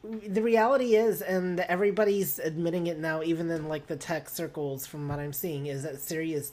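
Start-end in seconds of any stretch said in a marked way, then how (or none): none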